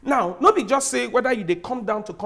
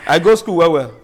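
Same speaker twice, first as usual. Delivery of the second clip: loud boast; 4 semitones lower, 6 dB louder, slower than usual. Real, not acted. Boasting he went to school.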